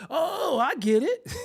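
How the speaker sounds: high mock "oh, I see"; mock realization. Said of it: "it was like".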